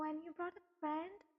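"friend" stressed and lifted as a question; emphasis on "friend". A narrator's reading. Older lady greets Jungkook's guest, stressing "friend". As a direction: lean on "friend" with a rising question. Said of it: "put an emphasis on the word".

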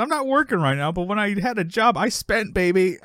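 high-pitched, hammed-up brag; imitating an indignant braggart; acted boast. Acting George's boastful line.

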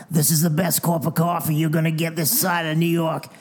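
lightly voiced old smoker lady, a hint of rasp; raspy cigarette voice. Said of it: "with this really raspy cigarette voice and goes".

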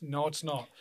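ordinary talk

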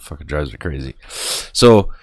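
ordinary talk

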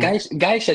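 "geisha" echoed as GUY-sha; Danish pronunciation. Retold or imitated. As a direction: imitate Danish "geisha" with an eye vowel. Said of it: "definitely sounds dansk".

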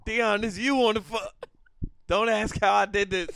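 Deion voice. Pretend. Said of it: "calling Deion in Deion voice".

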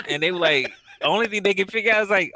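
usual speaking voice

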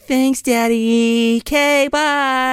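high, slow, syrupy mock-sweet voice; sarcastic sweet daughter. Julie sarcastically thanking her dad: high, slow, syrupy voice. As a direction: drag it out in a sugary fake-sweet tone. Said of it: "and she's like".

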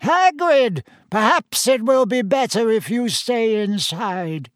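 put-on high-pitched reedy old functionary; reedy official. A narrator's reading. Committee member advises the owner to stay inside, reedy. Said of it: "said the reedy voice of the committee member".

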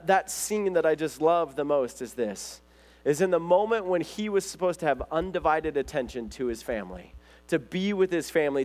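normal talking voice

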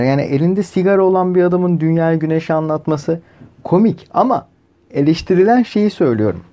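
normal talking voice

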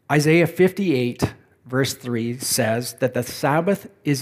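slow it down so each question can be dwelt on; slow, deliberate reading. Real, not acted. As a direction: read slowly with long pauses between thoughts. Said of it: "I'm gonna read these slowly".

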